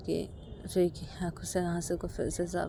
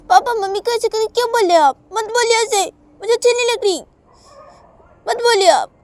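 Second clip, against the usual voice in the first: put-on very high, loud child's protest; angry little daughter; 16 dB louder than usual. Child telling papa off for mummy, high angry shouting.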